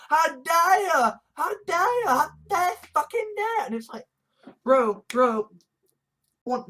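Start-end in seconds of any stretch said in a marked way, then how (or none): 0.1-3.7 mock shrill outrage. Mocking outraged critics.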